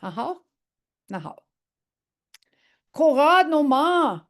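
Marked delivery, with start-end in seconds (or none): 2.9-4.2 loud, high order to get out; firm angry get out; stern command. Shown as a demonstration. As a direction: shoo them off sharply. Teacher orders someone out.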